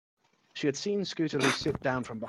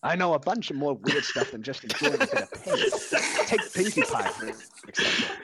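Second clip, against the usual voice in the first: amused; 5 semitones higher, 3 dB louder than usual. Acted. Dash amused, laughing.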